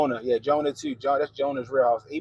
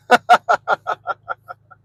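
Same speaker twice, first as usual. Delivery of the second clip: loud high laugh; 9 semitones higher, 10 dB louder than usual. Real, not acted. Laughs after his own line.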